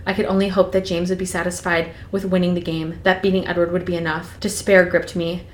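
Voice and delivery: steady storytelling read; reading Twilight aloud to a co-host; novel read aloud. Acted. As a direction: read it like an audiobook chapter.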